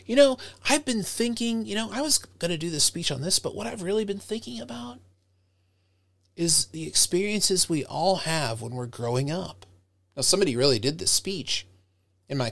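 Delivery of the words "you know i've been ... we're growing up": high, musing voice full of wonder; speculative wonder. Shown as a demonstration. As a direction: muse aloud with curiosity. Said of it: "Sound like you have some wonder".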